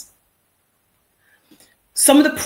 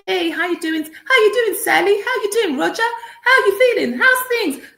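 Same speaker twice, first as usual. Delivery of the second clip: greetings pitched much higher and louder; higher, cheerful office voice; 13 semitones higher, 6 dB louder than usual. Retold or imitated. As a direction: put on a bright high workplace voice to sound friendly. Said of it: "Even your voice goes up".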